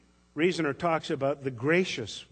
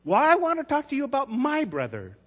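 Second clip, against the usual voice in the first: put-on much higher, louder child voice, cross; a small girl snapping right back; annoyed little girl; 14 semitones higher, 9 dB louder than usual. A narrator's reading. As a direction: Tess answering the pharmacist, annoyed. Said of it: "Tess answered in the same annoyed tone".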